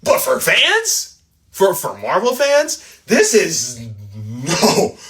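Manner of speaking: incredulous. Real, not acted. Incredulous question.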